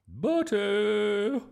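high, loud, stretched-out summons; booming. A narrator's reading. Miss Boot catching Bertie at the door, booming. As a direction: bellow the name furiously. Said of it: "boomed Miss Boot".

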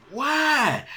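very high, drawn-out "why"; bewildered cry. Retold or imitated. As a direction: wail it in mock disbelief. Voicing bewilderment at the beating.

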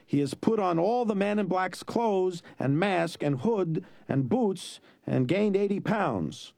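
high, slow sneering sarcasm; sarcastic mockery. A narrator's reading. Sicilian mocking Fezzik's reasoning, high and slow. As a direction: drip contempt on every word.

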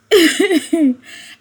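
high, loud burst of real laughter. Real, not acted. Confessing to viewers, a high loud real laugh. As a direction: laughs at her own past. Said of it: "I'm laughing because I used to be a culprit".

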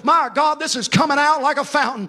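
loud, high, half-sung preaching; preaching at full force. Acted. Pastor urging his congregation to stay faithful, loud ringing preaching. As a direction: ring it out over the congregation like a revival.

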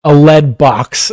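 ordinary talk, nothing marked

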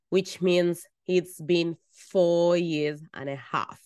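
usual speaking voice

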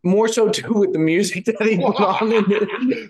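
loud, boomy far-off mic sound; talking through a bad headset mic, loud; in a tunnel. Real, not acted. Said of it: "Bob, you sound like you're in a tunnel right now".

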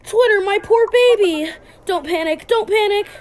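high, loud fretting mother; worried mother. Acted. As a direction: fret over the lost baby. Worried mother over her child.

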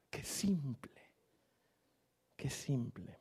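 hushed, amazed repeat; awed whisper. Retold or imitated. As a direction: breathe it in quiet wonder.

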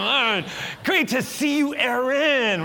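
loud, high, drawn-out hello; exuberant greeting. Retold or imitated. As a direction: shout it as if ambushing a friend with a hug. Hugging supposed friend from behind.

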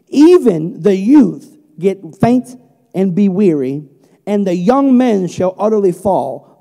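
slow, weighty preaching of the verse; scripture reading. Acted. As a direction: draw every phrase out with power. Reads scripture with force.

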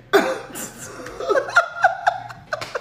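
a burst of high airy laughter; high breathy laugh. Real, not acted. Laughing at her friend's hand motion, high and airy. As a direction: laugh helplessly at the joke.

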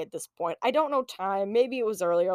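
ordinary delivery

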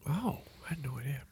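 breathy whispered aside; whispering. Pretend. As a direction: whisper it in mock surprise. Mock surprise in a whisper.

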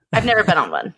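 ordinary talk

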